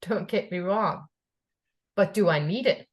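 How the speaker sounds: usual speaking voice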